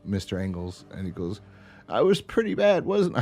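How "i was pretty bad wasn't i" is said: high, strained comic whine; a failed try at Bobcat's voice, pitched way up; badly done Bobcat voice, high and pushed; Bobcat impression. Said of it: "I can't do Bobcat's voice".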